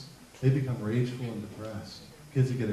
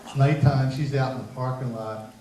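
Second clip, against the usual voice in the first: ordinary talk, nothing marked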